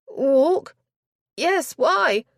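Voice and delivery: high, loud puzzled echo; a startled lady answering high and loud. Acted. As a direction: thrown by an odd question. Startled duenna.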